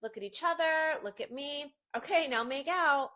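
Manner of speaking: cheeky punchline. Retold or imitated. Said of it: "so I just was like".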